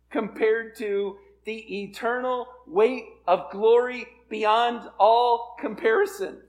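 swelling pulpit emphasis, slowing to the end; forceful preaching. Real, not acted. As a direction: preach it hard, word by weighty word. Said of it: "I'm gonna preach my way right out".

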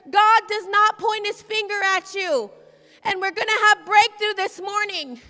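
rising to a loud preaching climax; preaching at full force. Acted.

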